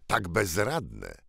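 ordinary delivery

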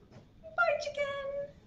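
very high, singsong goodbye; playful farewell. Pretend. Librarian saying goodbye to the toy chicken, high playful voice. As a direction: call a high little bye-bye.

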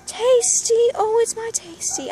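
high, cooing voice for a pet; doting owner cooing at her rabbit; pet talk. Real, not acted. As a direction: coo the bunny's name up high.

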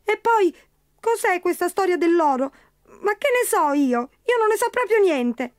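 high squeaky voice playing dumb; tiny creature feigning innocence; innocent leprechaun. Leprechaun denying gold to Pat, high squeaky innocence.

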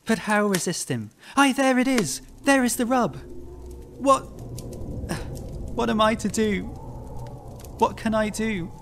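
high, agitated despair; desperate outcry. A narrator's reading. Haunted man at his wits' end, high desperate outcry. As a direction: cry out helplessly, pitched high.

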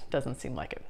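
muttered afterthought; quiet aside. Real, not acted. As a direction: repeat "Doesn't seem like it" under the breath, dropping away.

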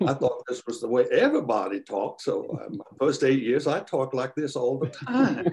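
hammed-up South Carolina drawl with a laugh at the end; playful, exaggerated Southern US accent. Shown as a demonstration. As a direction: lay on a lilting Southern drawl. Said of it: "to switch from a Southern drawl".

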